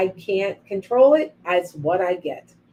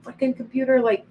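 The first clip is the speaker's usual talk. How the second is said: imitating her own mild yelling at the computer; a hint of a rant at the computer; mock yell; 6 semitones higher, 4 dB louder than usual. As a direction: replay a frustrated outburst, half-hearted. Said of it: "I was yelling".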